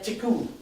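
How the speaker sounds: tiku with the little T sound brought out; Canadian French T sound. Shown as a demonstration. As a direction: get the small T sound into tiku. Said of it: "gotta get that little T sound".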